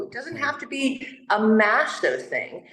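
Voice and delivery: ordinary talk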